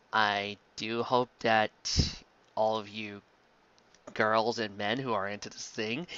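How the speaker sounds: slow, hushed, teasing aside; quiet, lingering, suggestive; sultry tease. Real, not acted. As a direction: lean in and tease the listeners. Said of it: "aside to listeners, teasing".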